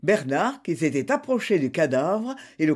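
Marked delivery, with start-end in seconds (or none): none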